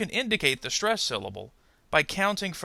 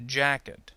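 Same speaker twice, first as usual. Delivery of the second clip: "Jacket" with a strong JACK and weak ending; first-syllable stress. Shown as a demonstration. Teacher gives two-syllable noun example, "Jacket" stressed on JACK. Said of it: "stress on the first syllable".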